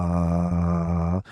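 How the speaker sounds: long, steady, low held "ah"; sustained "ah" voice exercise. Shown as a demonstration. Patient demonstrating his speech therapy drill, sustained "ah". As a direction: hold one even note on a single breath. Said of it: "they'd make you do this thing".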